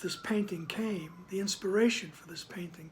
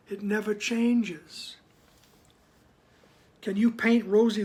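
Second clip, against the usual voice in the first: firm, louder reading of the text; formal reading; 5 dB louder than usual. Acted. Teacher reading scripture to the group, in a firm, louder reading voice. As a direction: read the passage out with weight.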